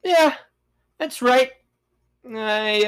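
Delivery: usual speaking voice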